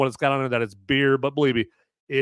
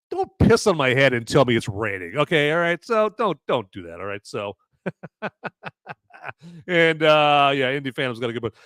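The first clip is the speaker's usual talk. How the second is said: normal talking voice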